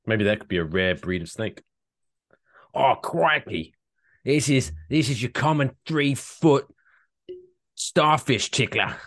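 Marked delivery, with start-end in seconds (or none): 2.7-9.0 crikey, wildlife host impression; Australian accent. Nature documentary presenter voice.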